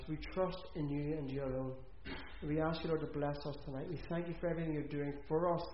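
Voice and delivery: hushed church prayer; closing prayer. Acted. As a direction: pray it gently to God.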